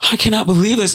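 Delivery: high, amazed disbelief. Retold or imitated. Caller texting his family, high amazed disbelief. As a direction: sound stunned and thrilled.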